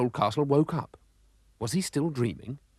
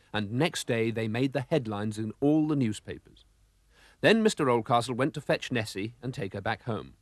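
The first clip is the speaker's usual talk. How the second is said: ordinary delivery, nothing marked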